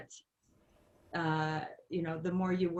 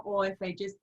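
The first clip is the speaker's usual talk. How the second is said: ordinary delivery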